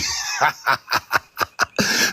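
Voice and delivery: soft breathy laugh; quiet chuckle. Real, not acted. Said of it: "I just came up to laugh".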